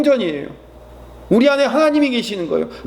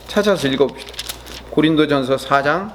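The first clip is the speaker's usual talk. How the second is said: ordinary talk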